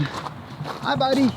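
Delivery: high, soft sweet talk to a horse; high pet voice. Real, not acted. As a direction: coo at the animal.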